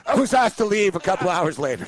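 laughing through the words; laughing while telling how he got kicked out. Real, not acted. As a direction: chuckle it out in disbelief. Laughing retelling of being kicked out.

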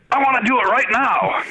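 high, insistent Welsh voice; Welsh accent. Retold or imitated. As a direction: demand it now like a brisk Welshman. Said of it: "you've got a really good Welsh impersonation".

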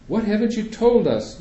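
a mother nearly shouting in alarm; mother bursting out at the doctor, almost yelling. A narrator's reading. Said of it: "Mom almost yells".

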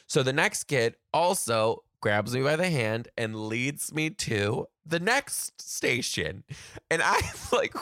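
ordinary talk, nothing marked

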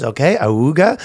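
goofy honking "auga"; clown honks and squeaks. Pretend. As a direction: honk out "auga" like a clown horn. Agent's noises.

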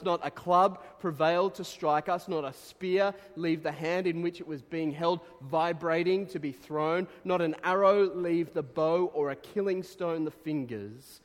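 rising into a ringing sermon climax; preaching at full force. Real, not acted. Preacher proclaiming God's protection to the congregation, building and loud. Reading the missionary's assurance aloud.

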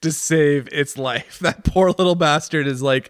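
talking through laughter; laughing. Real, not acted. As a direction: giggle through the words. Laughing at the clumsy robot.

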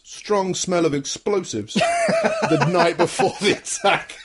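slow, laughing scare quotes. Retold or imitated. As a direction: say it through a chuckle. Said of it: "in quotes".

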